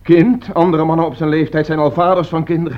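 exasperated father's outburst; indignant. Acted. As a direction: throw the word back in disbelief. Father scoffs at mother's excuse.

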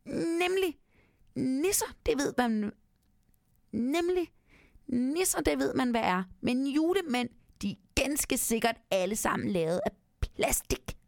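high, tiny elf voice from a dark corner; tiny nisse voice. Acted. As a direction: squeak it out like a little nisse. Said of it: "siger en ganske lille stemme".

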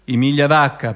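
normal talking voice

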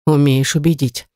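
understated grudging mutter; grudging, through teeth. A narrator's reading. Accomplice giving in to the kidnapper, grudging through teeth. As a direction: say it lightly through gritted teeth. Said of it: "процедил мужчина".

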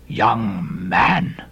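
low, drawn-out stern address; stern pious elder, stretching the words. A narrator's reading. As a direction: warn him gravely, pitched low. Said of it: "said Bildad sternly".

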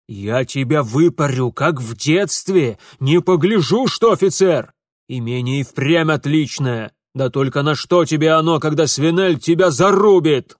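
loud angry father's dressing-down; furious threatening. Acted. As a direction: threaten him at full voice. Father threatens son with a thrashing.

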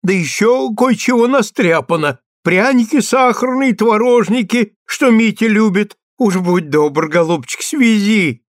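high, loud pleading granny; pleading old woman voice. Acted. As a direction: coax him like a fond grandmother.

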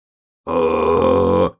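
long, loud, level holler; drawn-out bear cub cry; bear cub's yell. A narrator's reading. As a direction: holler at your own pitch. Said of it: "закричал медвежонок".

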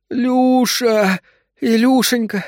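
very high, drawn-out wail. A narrator's reading. Labouring wife clings to her husband, high pained wailing. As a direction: howl the pet name in pain. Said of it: "завыла Вера".

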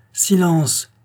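high, booming command; stentorian shout. A narrator's reading. Butcher over the din of the mob, shouting. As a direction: roar for silence. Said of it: "une voix de stentor".

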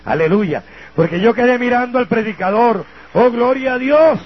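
loud, rising praise shout; preaching at full force. Real, not acted. Preacher praising God before the congregation, loud and fervent. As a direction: lift the hall with a fervent hallelujah.

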